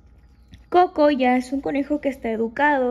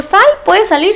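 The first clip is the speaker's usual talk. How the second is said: higher-pitched call to a pet; raised-pitch permission to go out; giving permission; 7 semitones higher, 12 dB louder than usual. Retold or imitated. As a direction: call the rabbit out, pitch lifted. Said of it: "cuando yo le digo".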